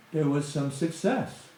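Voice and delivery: ordinary delivery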